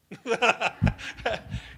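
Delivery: big high burst of laughter; loud real laugh. Real, not acted. Speaker laughing loudly at her own story.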